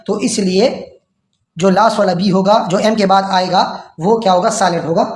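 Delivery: normal talking voice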